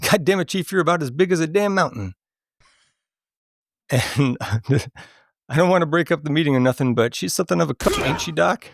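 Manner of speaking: a hint of a cocky asylum inmate; acted movie lines. Quizzing a friend with quotes, lightly voiced rough patient.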